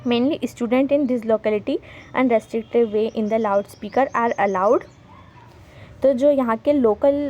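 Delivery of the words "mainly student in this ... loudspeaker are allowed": slow, careful dictation pace; slow read-aloud. Shown as a demonstration. Teacher reading the sample letter to students, slow dictation. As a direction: read each English word out slowly for the class.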